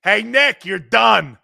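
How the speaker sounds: loud jeering shout; heckling fan. Retold or imitated. Host repeating the heckler's shout at Nick. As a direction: jeer like a fan in the stands. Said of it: "repeating the heckler".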